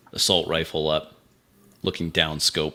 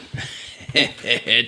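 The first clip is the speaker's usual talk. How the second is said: short in-character laugh, a bit higher; acted laughing; 5 semitones higher than usual. Soldier laughs at a comrade's tactics, acted chuckle. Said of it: "I start laughing".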